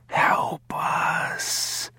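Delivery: unvoiced ghostly whisper; weak prisoners begging in a hush. A narrator's reading. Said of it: "they whispered".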